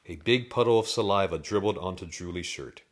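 ordinary delivery

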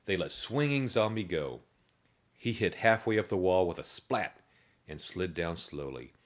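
usual speaking voice

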